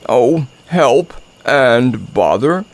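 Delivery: slow bear lament; each word drawn out in dismay; stuck storybook bear, slowly exclaiming; Pooh's voice. A narrator's reading. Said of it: "said Pooh".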